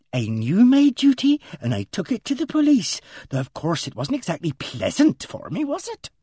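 prim spinster voice. Acted. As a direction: relish the scandal primly. Miss Ginch continues.

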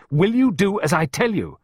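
high, loud demand; exasperated, forceful question; shouting. A narrator's reading. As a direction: demand obedience from the child. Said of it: "Clarissa shouted".

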